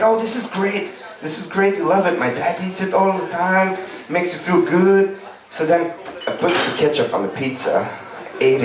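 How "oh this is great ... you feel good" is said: put-on higher eager kid voice; friend selling it. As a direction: talk it up like a sly kid. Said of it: "he was like".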